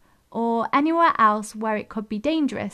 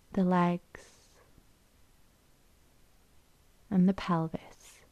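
unhurried, even delivery, leaving room to breathe; guided meditation; 4 semitones lower, 4 dB quieter than usual. Acted. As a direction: guide them gently into stillness, phrase by phrase.

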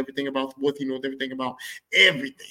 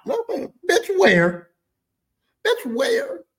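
incredulous; 14 semitones higher, 5 dB louder, much slower than usual. Real, not acted. Incredulous reaction to dad praise.

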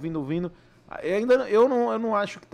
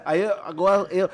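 ordinary delivery

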